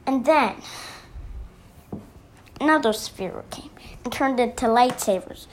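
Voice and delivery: ordinary delivery, nothing marked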